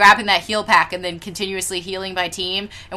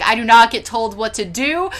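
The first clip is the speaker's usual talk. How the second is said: mock matter-of-fact; 4 semitones higher, 8 dB louder than usual. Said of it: "so matter-of-factly".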